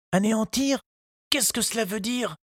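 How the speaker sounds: put-on high, polite little boy's voice; a small boy asking politely; young boy Tistou. A narrator's reading. Tistou asks the gardener, high child voice.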